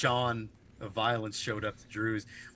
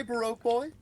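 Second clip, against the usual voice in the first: way up high, teasing; squeaky mock call-out; 12 semitones higher than usual.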